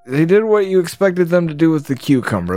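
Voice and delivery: ordinary delivery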